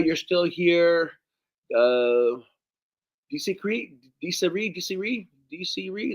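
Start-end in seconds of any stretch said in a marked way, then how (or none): 3.3-6.1 "D C Cree" then "D C Ree", softly tested; trying out a name. Real, not acted. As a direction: sound out "D C Ree" quietly. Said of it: "is that how you pronounce that?".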